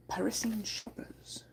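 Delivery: soft whisper. Acted. Streamer reads headline, whispering. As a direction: breathe the words.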